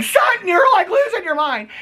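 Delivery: high-pitched mock shout; Kyle. Housewife confronting Sutton over dinner drinks, high imitated shout. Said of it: "Kyle's like".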